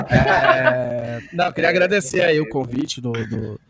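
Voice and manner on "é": hearty laugh. Real, not acted. Laughs after professor quote.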